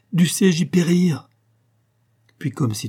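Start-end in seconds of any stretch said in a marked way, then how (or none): 0.1-1.3 higher, impassioned declaration; impassioned vow. A narrator's reading. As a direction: swear it with rising fervour. Hero vows to brave death.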